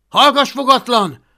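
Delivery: loud, high shouted hush order; Hablaty's sharp rebuke. Acted. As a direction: yell at the dragon to shut up. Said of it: "szólt rá Hablaty".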